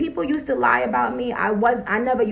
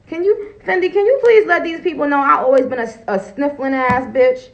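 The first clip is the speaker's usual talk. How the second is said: high, louder, quick plea to a friend; excited appeal; 5 semitones higher, 5 dB louder than usual. Real, not acted. Asking Fendi to vouch for her, high excited plea. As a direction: rush it, pitched way up, begging for backup.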